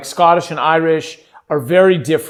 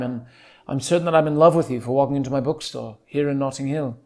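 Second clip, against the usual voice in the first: put-on British accent, lightly hurried and understated; quiet, posh-British film-star impression; Hugh Grant; 3 dB quieter than usual. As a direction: charm them in a soft English accent. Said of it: "I just automatically imagine Hugh Grant".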